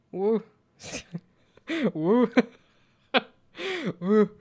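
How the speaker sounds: repeated high, loud gnome "woo"; high, punchy mock gnome hoot; imitating the gnome sound. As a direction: try gnome whoops one after another, laughing between. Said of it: "gnome sound is".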